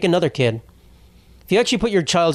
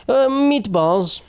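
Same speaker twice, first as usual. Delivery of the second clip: put-on high, slow tired old lady drawl; old lady slaving away; 8 semitones higher, much slower than usual. Ghostwriter lady mumbling her recipe, slow and high. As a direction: drag it out like a worn-out cook.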